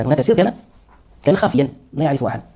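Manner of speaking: ordinary talk, nothing marked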